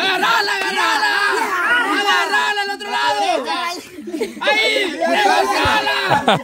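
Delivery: high-pitched urgent shouts; excited shouting. Real, not acted. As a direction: yell directions over the crowd. Yelling directions in the game. (gritos agudos de emoción)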